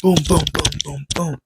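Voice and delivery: string of sound effects tailing off; imitated explosions. Vlogger imitating portals opening, high booms fading.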